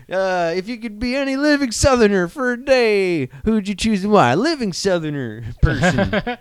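put-on high Southern game-show host accent. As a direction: commit to the Southern accent, hammy. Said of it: "We're committing to it".

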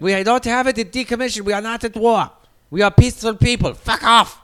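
put-on high, loud German-accented rant; belligerent German guy. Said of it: "Whoa, German guy, calm the fuck down".

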